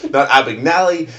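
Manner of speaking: "Abagnale" as "Abag-nally", loud and high; wrong pronunciation. Shown as a demonstration. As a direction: punch out "Abagnale" with a "-nally" ending. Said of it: "the way you don't pronounce it".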